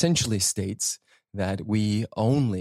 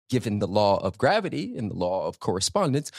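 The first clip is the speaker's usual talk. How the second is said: ordinary talk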